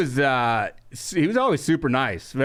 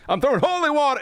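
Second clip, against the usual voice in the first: higher, mock preacher voice; Earthquake impression; 9 semitones higher than usual. Comic mimicking Earthquake on stage, higher and hammed up. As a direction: ham up the comic throwing holy water. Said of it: "so I'm doing Earthquake impressions".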